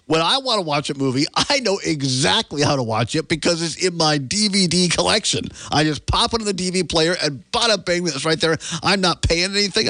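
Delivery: high-pitched, playful self-defence; indignant rant. Real, not acted. Playful rant defending his DVD collection.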